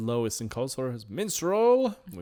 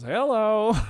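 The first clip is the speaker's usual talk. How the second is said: high, loud spooky hello; ghost greeting; 11 semitones higher, 9 dB louder than usual. Retold or imitated. Ghost greeting Finn and Jake, high and loud.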